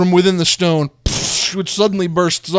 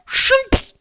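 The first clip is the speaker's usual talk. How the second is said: sound effect; 5 dB louder than usual. Acted. Sound of gem sticking.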